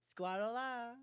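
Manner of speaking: high, loud yell; shouted cry. Acted. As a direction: belt out a battle cry. Pegasus yells a battle cry.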